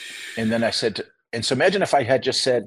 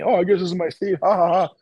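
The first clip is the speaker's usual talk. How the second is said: lightly voiced tipsy woman, higher, with a mock laugh; a hint of a drunk passenger giggling; tipsy lady; 5 semitones higher than usual. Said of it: "a little bit drunk".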